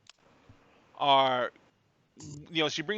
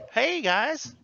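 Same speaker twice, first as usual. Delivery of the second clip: lightly voiced Southern waitress, pitched up; Southern waitress accent; 10 semitones higher than usual. Waitress greeting new diners, light Southern accent. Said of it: "You throw on that accent".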